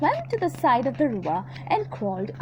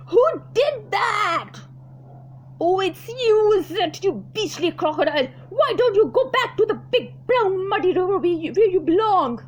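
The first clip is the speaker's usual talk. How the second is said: higher, louder cross elephant; indignant elephant; 6 semitones higher, 4 dB louder than usual. Acted. Elephant telling the crocodile off, indignant. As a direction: shoo the beastly croc away.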